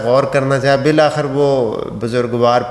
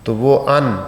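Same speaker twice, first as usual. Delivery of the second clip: usual speaking voice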